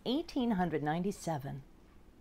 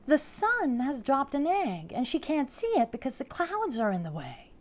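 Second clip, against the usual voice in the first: high, louder elf voice; eager elf explaining, pitched up; excited little elf; 8 semitones higher, 6 dB louder than usual. Acted. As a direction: announce the discovery in a high storybook voice. Elf announces her theory.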